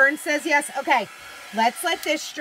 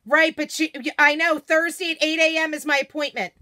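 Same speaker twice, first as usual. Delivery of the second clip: raised voice, higher and louder; calling out; 3 semitones higher, 5 dB louder than usual. Real, not acted. Host arranges an appointment with someone off camera, calling out. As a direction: call through to another room.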